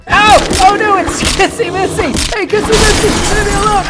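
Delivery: pained yelp. Pretend. Character attacked by Kissy Missy.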